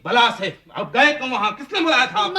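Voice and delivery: loud, high, furious yelling with a laugh; drunken angry shout. Acted. Drunk son rounds on his father for coming, furious laughing shout. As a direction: scream it at him, half laughing in contempt.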